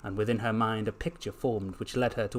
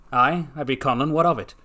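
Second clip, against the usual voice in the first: attempted Scottish accent, loud; gruff challenge in a rough Scottish accent; Peter Capaldi; 3 semitones higher, 8 dB louder than usual. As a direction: try an imperfect Scottish shopkeeper. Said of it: "a rather Scottish-sounding chopper replied".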